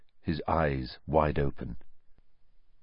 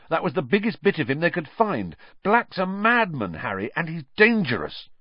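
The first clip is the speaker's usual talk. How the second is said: higher, louder urgent warning; heated Ron; 8 semitones higher, 4 dB louder than usual. A narrator's reading. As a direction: hammer the danger home. Ron urgently warning, high loud.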